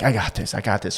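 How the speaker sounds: low-key, soft pep talk to oneself; softly reassuring yourself; self-talk. Retold or imitated. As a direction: psych yourself up under your breath. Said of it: "this is all I'm saying to myself".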